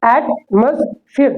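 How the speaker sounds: "एटमॉस्फेयर" slowly as at-mus-fir; correct pronunciation, broken up. Shown as a demonstration. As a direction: weaken the middle of "एटमॉस्फेयर", syllable by syllable. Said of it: "इसे पढ़ते क्या है". ("एटमॉस्फेयर" को धीरे से ऐट-मस-फिर)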